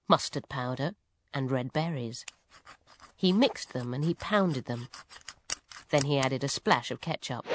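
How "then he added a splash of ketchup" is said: plain reading voice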